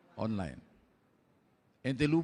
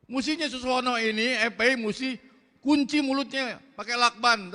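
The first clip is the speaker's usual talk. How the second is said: ordinary delivery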